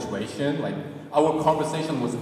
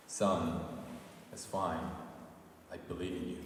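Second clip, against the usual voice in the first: solemn TV dad; 6 semitones lower, 9 dB quieter than usual. Retold or imitated. Korean drama dad in the car to his son, solemn and lower. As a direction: lower and softer, grave and tender.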